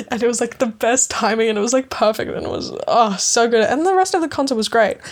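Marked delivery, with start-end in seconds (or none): none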